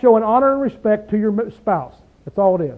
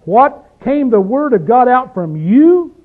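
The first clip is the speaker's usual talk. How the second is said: loud, high, heavily emphasized rhetorical question; sarcastic disbelief; 3 semitones higher, 5 dB louder, slower than usual. Acted. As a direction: challenge them slowly, incredulous, rising at the end. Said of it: "He's putting real emphasis on these words".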